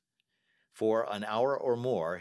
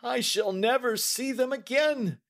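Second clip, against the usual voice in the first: much higher, louder despairing wail; anguished cry; 6 dB louder than usual. A narrator's reading. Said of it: "he cried".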